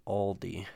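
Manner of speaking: Aldi with a clearly sounded L; L pronounced. Shown as a demonstration. Correcting for those not from Virginia, Aldi with its L pronounced. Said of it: "that word has an L in it".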